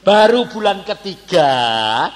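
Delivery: loud, drawn-out lament; dramatic build-up. Real, not acted. Preacher counts months of tears before demotion, dramatic. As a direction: stretch each phrase out slowly and high.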